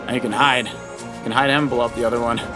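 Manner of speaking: usual speaking voice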